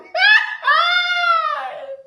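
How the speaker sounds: high shrieking laugh; loud laugh. Real, not acted. Laughing at the belly joke to viewers. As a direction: burst out laughing.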